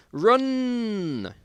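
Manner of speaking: high, loud cry to run; shouted warning. Acted. Fleeing zombies, yelling at his friend to run. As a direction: scream it as the zombies burst in.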